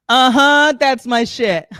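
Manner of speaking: a hint of a potty-trained two-year-old showing off. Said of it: "two-year-old [name] who just got potty trained".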